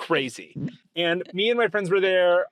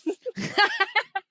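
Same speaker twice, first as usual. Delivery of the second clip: laugh. Real, not acted. Laughs at own story.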